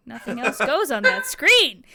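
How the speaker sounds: loud, emphatic outburst; exasperated scolding. Real, not acted. Game master telling off the computer, loud and exasperated. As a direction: raise the voice at a disobedient machine.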